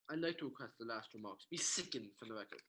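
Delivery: "sickened" said in place of stricken. Acted. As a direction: slip on the word "sickened". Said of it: "I said sickened".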